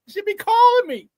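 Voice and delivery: very high, whiny mock-addict squeal; Pookie. Host jonesing for Bears talk, mock-addict impression. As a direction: squeal it like a craving junkie, hammed up. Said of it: "I feel like Pookie in New Jack City".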